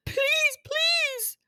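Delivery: high wailing sob into please please; dragon crying. Acted. Weeping dragon begs Morton, high sobbing plea. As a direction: wail and beg through tears. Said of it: "the huge green dragon started to cry".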